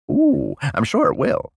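high-pitched, eager innuendo; suggestive. Acted. Old prisoner welcoming the probing table, suggestive. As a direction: swoop up high on "ooh", relishing it. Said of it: "said with wiggling eyebrows".

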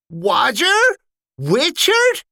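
name each in growing amazement; startled recognition. Acted. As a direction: soldier spotting both his brothers. Ralph recognising brothers.

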